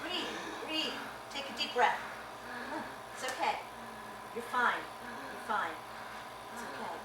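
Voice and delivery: soft, quiet reassurance; calm him down gently; a nurse soothing her panicking patient. Acted.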